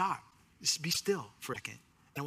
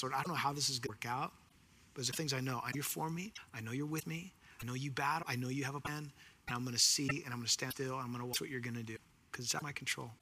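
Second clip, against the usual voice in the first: softer, hushed trusting prayer; quiet prayer; 3 semitones lower, 5 dB quieter, faster than usual. Acted. Preacher models his own prayer to the Lord, hushed and trusting. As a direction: pray it gently, handing it over to the Lord.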